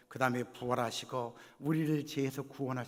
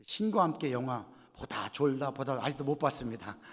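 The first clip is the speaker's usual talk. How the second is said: ordinary delivery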